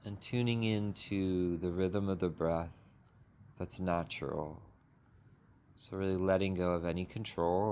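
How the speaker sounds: unhurried, gentle meditation guidance with long pauses; guided meditation. Acted. Teacher guiding the group into a sitting meditation, calm and unhurried. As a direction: ease the group into stillness, one phrase at a time.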